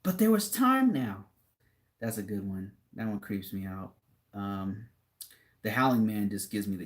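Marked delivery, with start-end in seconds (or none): 0.0-1.2 a higher, anguished wail at his broken glasses; imitating a heartbroken old reader; crying out that there was time now; anguished bookworm. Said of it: "And he's like".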